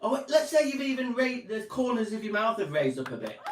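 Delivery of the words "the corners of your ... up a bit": ordinary delivery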